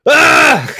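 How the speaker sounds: sudden high shout of rage; Doctor Doom losing it on the phone; furious yell. Retold or imitated. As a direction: explode into a yell. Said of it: "He's just like".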